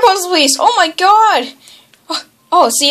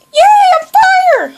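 high, slow gleeful yay; excited cheer; 6 dB louder, slower than usual. Real, not acted. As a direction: stretch it out in delight. Cheering at getting fire.

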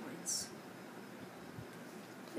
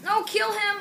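excited yell; 11 semitones higher, 5 dB louder than usual. Real, not acted. Urging teammate during gunfight.

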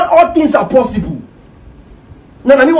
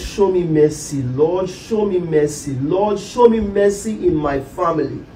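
pleading prayer; 5 semitones lower, 5 dB quieter, slower than usual. Acted. Husband praying for mercy.

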